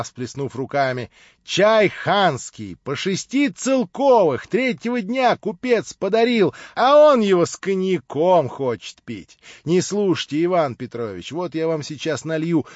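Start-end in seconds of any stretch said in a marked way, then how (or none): 1.4-12.7 higher, louder, slow fussing; wife indignant her fine tea is spoiled with cognac; indignant housewife. Acted. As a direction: defend the precious tea, outraged. Wife scolds, pours tea.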